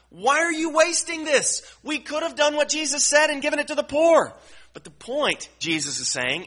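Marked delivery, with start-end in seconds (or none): 0.1-4.3 high, loud mock complaint; complaining critics. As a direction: mimic the grumbling onlookers. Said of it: "they respond".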